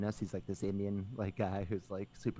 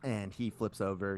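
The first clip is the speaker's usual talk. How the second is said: normal talking voice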